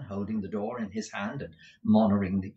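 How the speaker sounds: ordinary delivery, nothing marked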